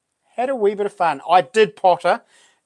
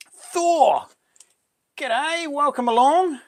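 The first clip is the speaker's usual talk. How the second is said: excited greeting; 11 semitones higher, slower than usual. Real, not acted. Greeting a viewer joining stream.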